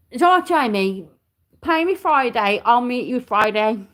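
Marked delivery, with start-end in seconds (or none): none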